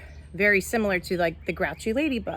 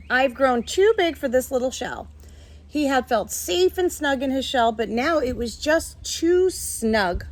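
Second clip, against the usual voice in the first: lively, higher storybook read-aloud; reading to children; 6 semitones higher than usual. A narrator's reading. Teacher reading a picture book to her class, lively storytelling.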